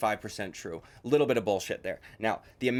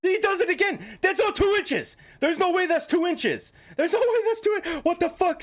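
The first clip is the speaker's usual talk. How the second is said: much higher, louder disbelief; incredulous shriek; 4 dB louder than usual. Real, not acted. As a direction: screech it like you can't believe it. Shrieks at the two-inch claim.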